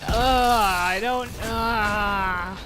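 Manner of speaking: loud, slow, fed-up groaning reply; exasperated groan. Retold or imitated. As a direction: drag it out like you're sick of being asked. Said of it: "He's like".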